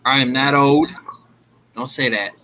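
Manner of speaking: ordinary talk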